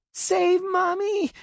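put-on very high, loud, slow little-girl wail; a little girl howling for help; child screaming for her mother; child's howl. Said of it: "she howled".

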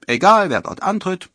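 high, loud, confident self-talk; cocky. A narrator's reading. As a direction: boast it with a lift. Robin cockily talks to himself.

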